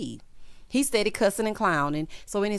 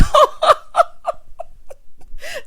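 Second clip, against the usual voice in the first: very high, loud, long cackle; wheezing high-pitched laughing fit; helpless laughter; 8 dB louder than usual. Real, not acted. Host cracking up over his cartwheel, helpless laughter.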